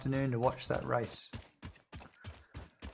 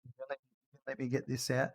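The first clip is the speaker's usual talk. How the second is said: soft pet talk; 6 dB quieter than usual. Real, not acted. Owner cooing softly to his old dog Randy, tender.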